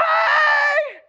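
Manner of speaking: high, loud cheerful yelp; goofy Forrest Gump hey. Retold or imitated. Preacher mimicking the Forrest Gump greeting, high yelp. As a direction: call out like a delighted simpleton. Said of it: "brother, like".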